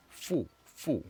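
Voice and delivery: teacher demonstrating foo; French sound. Shown as a demonstration. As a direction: model the foo sound, drawn out. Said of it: "the sound we're looking at here".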